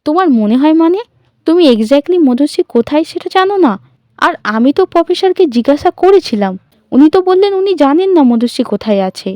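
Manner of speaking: high, loud angry questioning. A narrator's reading. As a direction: confront her, angry. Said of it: "Sharmistha এবার রেগে বলে". (রাগত স্বরে প্রশ্ন)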